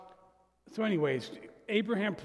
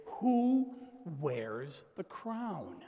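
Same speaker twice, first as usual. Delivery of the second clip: slow; much slower than usual. Real, not acted. Rhetorical question slowed.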